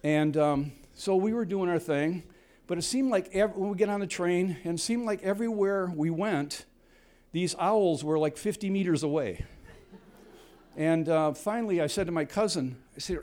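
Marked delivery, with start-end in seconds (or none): none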